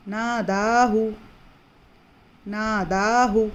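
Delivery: नादाहू slowly with the दा held two counts; stretched vowel. Shown as a demonstration. Teacher drilling students on the long दा in नादाहू. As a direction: draw out the दा in नादाहू. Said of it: "ये भी दो हरकत".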